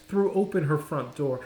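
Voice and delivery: usual speaking voice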